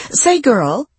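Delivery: high-pitched hail to a passer-by; lightly acted call across the street. A narrator's reading. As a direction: call out to get her attention, without shouting. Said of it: "a woman called out".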